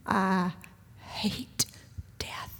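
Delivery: broken whisper, one word at a time; hushed grief. Retold or imitated. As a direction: whisper it like words that cost everything. Husband's grief retold in whisper.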